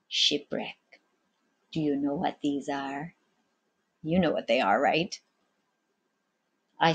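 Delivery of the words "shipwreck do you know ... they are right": hushed secret. A narrator's reading. Captain shows secret stones.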